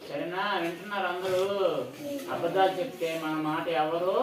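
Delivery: loud, emphatic preaching of the moral; moral lesson. Acted. Storyteller tells the listening children never to lie, preaching loudly. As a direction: drive the lesson home to the children.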